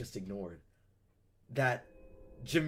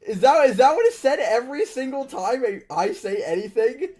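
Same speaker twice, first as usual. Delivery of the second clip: indignant outburst; 6 semitones higher, 5 dB louder than usual. Real, not acted. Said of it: "streamer indignant at being called nerd".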